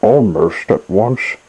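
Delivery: usual speaking voice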